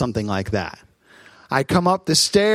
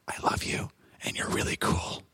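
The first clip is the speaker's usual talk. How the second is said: breathy whispered secret in the ear; 12 dB quieter than usual. Retold or imitated. Daughter whispering a secret into her father's ear. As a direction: whisper it right into his ear. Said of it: "She whispers in my ear".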